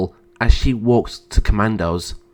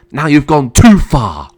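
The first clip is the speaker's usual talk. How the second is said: loud, raised angry threat; enraged; 4 semitones higher, 7 dB louder than usual. Acted. Emperor about to attack his sister, enraged. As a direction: let the rage spill over. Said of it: "went into a rage".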